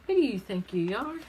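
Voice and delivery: snide parrot challenge. Acted. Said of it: "when it says".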